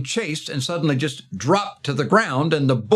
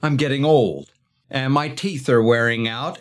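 normal talking voice

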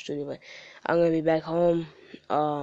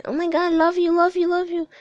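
high squealing mock fangirl, louder; gushing fan; 14 semitones higher, 8 dB louder than usual. Mocking his fans to the listener, high gushing squeal. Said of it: "they put in".

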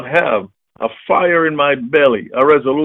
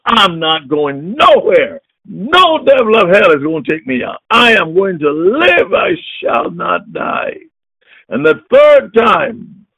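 preaching at full force; 6 semitones higher, 3 dB louder than usual. Acted. Preacher vowing to his congregation to live, full-force preaching. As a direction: declare it like a vow.

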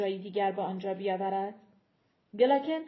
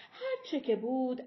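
ordinary delivery, nothing marked

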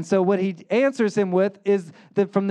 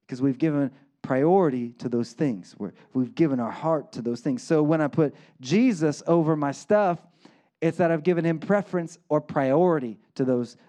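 ordinary talk

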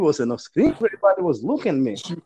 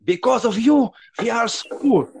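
put-on old lady screaming; 6 semitones higher than usual. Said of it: "she starts screaming".

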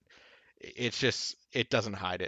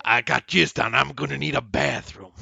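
loud, put-on TV character voice; Mother's Milk; 3 semitones higher, 7 dB louder than usual. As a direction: belt it out as a character impression. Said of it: "That's my mother's note".

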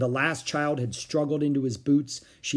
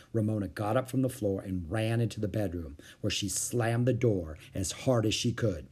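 plain reading voice

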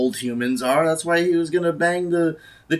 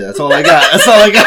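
laughing; 11 dB louder, faster than usual. Real, not acted. Laughs after failed impression.

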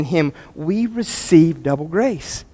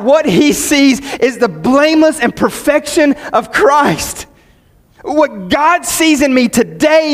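higher, slow preaching climax; impassioned preaching; 7 semitones higher, slower than usual. Real, not acted. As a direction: proclaim it slowly with conviction. Preaching at full force.

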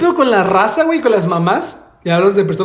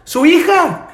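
higher, urgent call to a stranger; 7 semitones higher than usual. Retold or imitated. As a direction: call out to warn the lady. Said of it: "Entonces corrí y dije". (llamando a la señora con urgencia)

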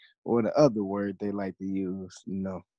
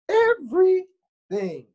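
Everything, louder and higher; emphatic; 10 dB louder, slower than usual. Real, not acted. Co-host agrees, stressing everything. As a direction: punch the word everything.

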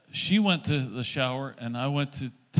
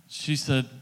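ordinary talk

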